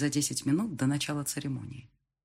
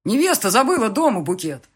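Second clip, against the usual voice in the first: loud explanation at raised pitch; calling out; 6 semitones higher, 8 dB louder than usual. A narrator's reading. Guest explaining forgotten bouquet to clerk, calling out. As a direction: shout it across the crowd.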